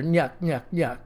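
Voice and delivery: a soft nyuk-nyuk chuckle; Curly's nyuk nyuk. A narrator's reading. Curly laughs at his own joke with nyuk nyuk. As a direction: chuckle it in three goofy beats. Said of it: "his familiar".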